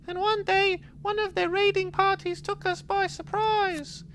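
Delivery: put-on high soft voice of a lonely old monk. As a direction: read the weary old monk. Reads monk dialogue in voice.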